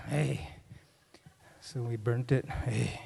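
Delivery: usual speaking voice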